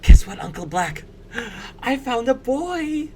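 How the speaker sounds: high, squeaky, bursting secret; excited stage whisper. Acted. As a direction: squeal it under your breath, drawing out the news. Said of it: "she's gonna whisper".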